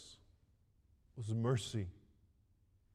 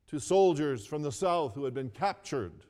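ordinary talk

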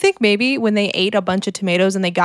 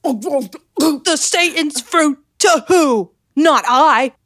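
put-on old-timey proclamation; 5 semitones higher, much slower than usual. Mock old-timey declamation.

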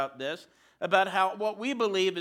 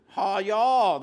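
louder joking southern "Ha, y'all"; mock southern twang; 6 dB louder than usual. Shown as a demonstration. Pastor jokes about Russ greeting the church, mock southern. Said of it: "that's quite another statement".

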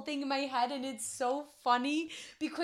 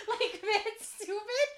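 laughing; 8 semitones higher, much slower than usual. Real, not acted. Laughing at his own antics.

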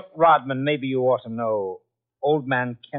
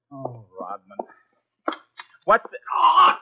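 ordinary talk, nothing marked

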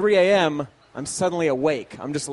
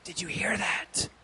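hushed; 12 dB quieter than usual. Retold or imitated. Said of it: "And she goes".

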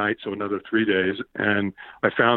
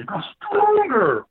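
very high, drawn-out yell; stretched full-voiced retold scream; imitated trainer's scream; much slower than usual. Trainer right in his face, drawn-out screamed demand. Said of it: "he screams at me".

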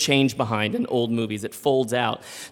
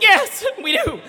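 much higher, laughing through the words; nervous laughing yes; 5 dB louder than usual. Retold or imitated. Two men nervously agreeing to the attendant, laughing.

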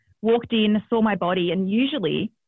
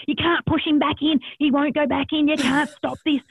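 urgent protest; 8 semitones higher than usual. Retold or imitated. Protesting to husband mid-birth.

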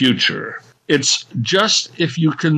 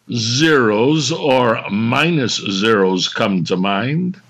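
ordinary talk, nothing marked

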